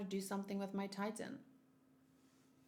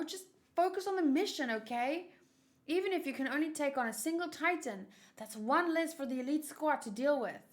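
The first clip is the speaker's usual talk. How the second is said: higher, eager soldier voice urging focus; rally your comrades, pitched up; upbeat young soldier; 6 semitones higher, 6 dB louder than usual. Acted.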